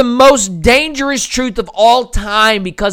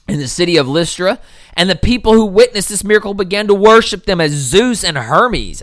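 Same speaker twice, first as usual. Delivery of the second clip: ordinary talk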